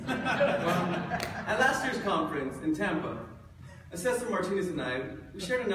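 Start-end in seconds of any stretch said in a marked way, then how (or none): 0.1-1.2 put-on Australian accent. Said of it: "this awful Australian accent".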